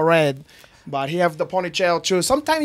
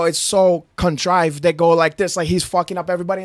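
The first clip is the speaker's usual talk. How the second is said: ordinary talk, nothing marked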